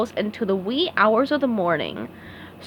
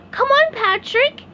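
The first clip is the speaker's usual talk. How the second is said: very high eager call; yelled; 4 dB louder than usual. Acted. SpongeBob calls Patrick to follow, high eager call. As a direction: call him along cheerfully. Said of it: "he yelled".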